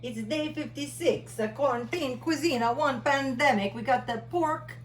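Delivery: put-on a playful cooking-show Italian accent. Hosting a quarantine cooking show in Italian accent. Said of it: "That's my Italian accent".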